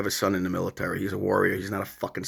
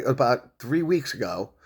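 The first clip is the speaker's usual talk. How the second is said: ordinary talk, nothing marked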